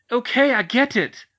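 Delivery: loud, high shout; shouting to stop the lecture. Acted. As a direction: shout it back, cornered. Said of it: "Cho shouted".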